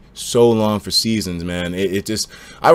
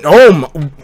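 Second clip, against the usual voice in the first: loud, stunned "Whoa!" that drops away; reeling from sudden bad news; shocked; 7 semitones higher, 7 dB louder, much slower than usual. Real, not acted. Streamer reading that the creator died, loud and stunned.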